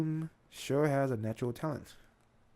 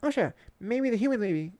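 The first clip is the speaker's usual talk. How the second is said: lightly higher, louder squirrel voice; talking squirrel; 8 semitones higher, 7 dB louder than usual. Acted. Squirrel answering Squirrel Girl, animated character voice. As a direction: pipe up emphatically as the squirrel. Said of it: "The squirrel says".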